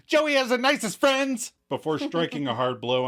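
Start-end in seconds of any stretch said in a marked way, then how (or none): 0.1-1.5 a hint of a manic high cartoon villain; Harley Quinn-style. Host imitating Katie before she punches Rachel, manic and high. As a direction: lightly play her giddy and unhinged. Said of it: "laughs psychotically like Harley Quinn".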